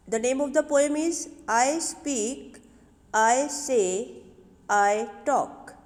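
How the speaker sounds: loud, slow announcement of the poem's title; announcing the poem title. Shown as a demonstration. Teacher announcing the poem's title to her pupils, loud and slow. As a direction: present the title to the class, slowly.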